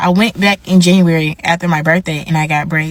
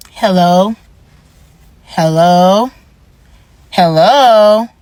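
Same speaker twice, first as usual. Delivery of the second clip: repeated hellos, rising and growing louder; calling hello; 4 dB louder, much slower than usual. Retold or imitated. Stylist calling out to the client, getting louder. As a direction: call out to get her attention, louder each time.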